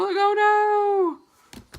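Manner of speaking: loud high cry of dismay; mock horror; dismayed gasp. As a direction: gasp then wail oh no. Gasp at stomach pain.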